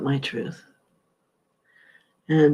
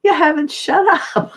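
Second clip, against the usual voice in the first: much higher and louder, laughing through the words; amused retelling with a laugh; laughing girlfriend; 14 semitones higher, 6 dB louder than usual. Retold or imitated. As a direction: tease her through laughter. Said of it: "She goes".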